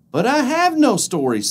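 high, loud puzzled weasel. Acted. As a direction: protest like a dim weasel. Weasel answers.

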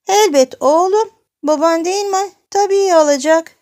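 high, loud granny voice; old granny. Acted. Koca nine çocuğa karşılık veriyor. (yüksek, tiz perdeden koca nine sesi)

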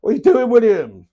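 loud, high cry sliding down; mock exasperation. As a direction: call him out in playful disbelief. Mock protest at William joining.

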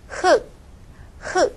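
흙 pronounced as 흑, ㄹ silent; pronunciation review. Shown as a demonstration. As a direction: read out 흙 with a stopped k ending. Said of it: "把这个现象来读一遍". (复习示范：흙读成흑)

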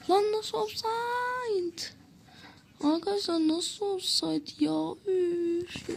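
slow, high, stretched-out protest; can't believe the offside call; drawn-out protest at the referee's offside decision; outraged disbelief. Real, not acted. Goal disallowed for offside.

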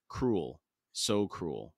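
soft, low bitter murmur; quiet. A narrator's reading. Duiker's inner thought, grief.

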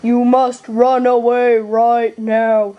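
slow, solemn put-on dad voice; solemn father figure. Father warning his son to run, slow solemn voice. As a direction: intone the warning slowly.

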